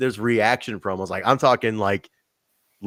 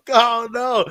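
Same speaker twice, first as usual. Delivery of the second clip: dismayed; 13 semitones higher than usual. Retold or imitated. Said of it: "reacting to ruined promo".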